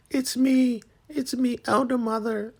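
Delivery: very high, small put-on voice; timid Zane. Zane answering Elder Mother, high and timid. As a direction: peep out meekly. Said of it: "Zane pops a little head out like".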